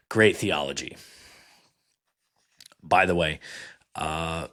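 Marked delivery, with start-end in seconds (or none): 0.1-1.0 dry, sarcastic praise; mock approval, lower. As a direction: say it as a sarcastic jab. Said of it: "that was sarcasm".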